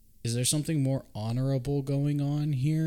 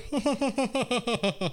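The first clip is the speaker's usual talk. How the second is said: mock cackle; 12 semitones higher than usual. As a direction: cackle like a creepy knight riding off.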